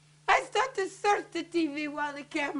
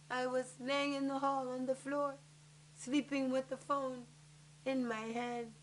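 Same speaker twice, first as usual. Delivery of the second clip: subdued; 4 semitones lower, 11 dB quieter than usual. Acted. Spent, recounting her collapse.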